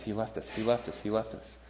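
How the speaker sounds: a hint of a scared girl murmuring over and over; hushed repeated plea. Frightened girl repeating to the hero, hushed. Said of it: "she can't say anything else".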